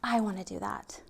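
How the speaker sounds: breathless little whisper of longing; awed whisper. Retold or imitated. Child at the concert whispering to herself in awe. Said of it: "I would sit there and be like".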